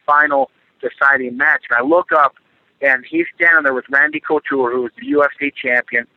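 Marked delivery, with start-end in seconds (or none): none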